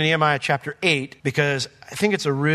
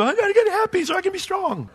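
much higher, straining mock voice of someone forcing cheer; a hammed-up, squeaky pep talk to yourself; mocking self-talk. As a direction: parody the person trying to pump themselves up. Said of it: "You try to do".

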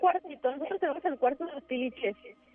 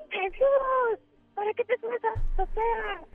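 higher mock shout at the boy; mother's scolding yell; 8 semitones higher than usual. Mom scolds her son for climbing to the roof, higher mock yell. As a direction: call up the stairs like a cross mom. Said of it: "mi mamá le gritó".

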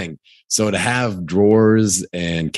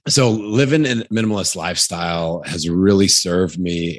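ordinary talk

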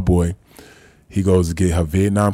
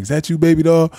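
put-on high, sweet old-lady call; lightly voiced grandma greeting her grandson; imitating grandma; 11 semitones higher, 5 dB louder than usual. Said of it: "she's like".

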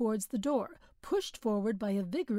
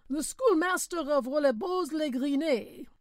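driver calling out; 5 semitones higher, 4 dB louder than usual. A narrator's reading. Driver calling passenger names.

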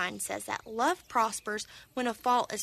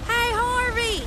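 loud, high call across a field; 13 semitones higher, 8 dB louder, slower than usual. Acted. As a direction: shout a greeting from far away. Tractor calls out across field.

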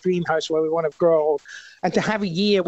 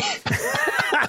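laughing; 3 dB quieter than usual. Real, not acted. Laughs at own joke about Jane.

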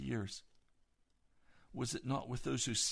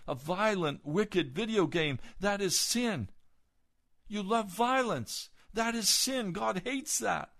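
rising fiery preaching; 5 semitones higher than usual. Acted. Preacher denouncing sins to the congregation, building to fiery.

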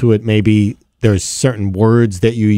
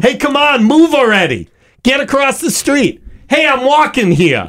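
put-on Brooklyn accent; 13 semitones higher than usual. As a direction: shout it like an angry Brooklyn pedestrian. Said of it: "if there was some swearing".

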